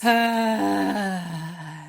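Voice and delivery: open, loud ah letting everything go; releasing sigh. Shown as a demonstration. Showing how everyone should release tension, with a loud open ah. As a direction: let the breath out in one open sound. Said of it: "you just need to be like".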